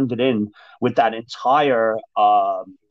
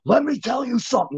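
retold furious boss; 9 semitones higher than usual. Boss furious at the staff in the huddle, higher mock yell. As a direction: imitate an angry boss starting to yell. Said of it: "He comes in, he's furious".

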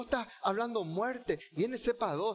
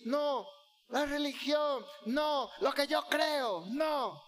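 stubborn refusal; 6 semitones higher, slower than usual. Retold or imitated. Listener voiced refusing Christ.

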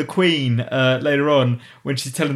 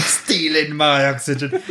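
savoring the quote, slow; slower than usual. Retold or imitated. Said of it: "Look at these disgusting trees stealing my oxygen".

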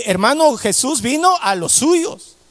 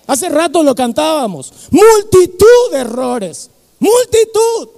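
slow, loud, higher preaching; rising preaching; 5 semitones higher, 3 dB louder, slower than usual. Acted. Preacher stressing that God's love covers all faults, slow and climbing. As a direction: build to a fervent climax.

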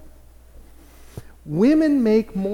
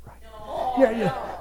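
usual speaking voice

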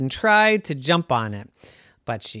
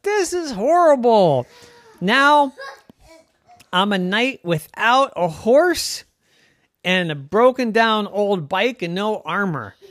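put-on despairing girl knight; 7 semitones higher than usual. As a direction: wail over her lost horse and armor. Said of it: "and she thought".

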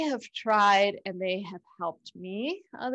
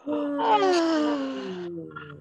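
long audible sigh out; big exhale through the mouth; dramatic sigh; 6 semitones higher than usual. Shown as a demonstration. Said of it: "and a big sigh out".

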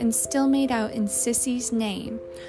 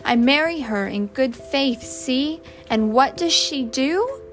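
louder, indignant rhetorical asking; rhetorical question; 4 semitones higher, 6 dB louder than usual. A narrator's reading. Husband airing his grievance to the family, rhetorically. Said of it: "he asked rhetorically".

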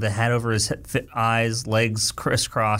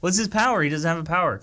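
high-pitched comic protest, breaking into a laugh; mock disbelief; 5 semitones higher, faster than usual. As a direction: protest the absurdity, amused. Mocks the feeble superpower.

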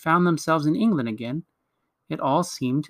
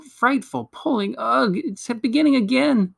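higher-pitched, agitated dismay; growing alarm; 9 semitones higher than usual. A narrator's reading. Edmund feels the dragging return, alarmed. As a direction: react as the pull grips you.

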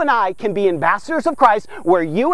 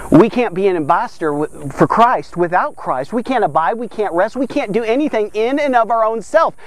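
ordinary talk, nothing marked

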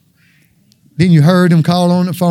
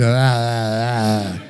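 put-on lower, softer wordless baby ah ah; baby babble; 8 semitones lower, 6 dB quieter, much slower than usual. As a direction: babble instead of lying, like a baby. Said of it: "do like that baby".

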